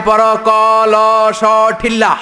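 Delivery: slow, high and loud sing-song recital, like a village teacher; drawn-out mock classroom chant of each word; Chittagong spelling drill. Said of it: "আবার বানান করায়".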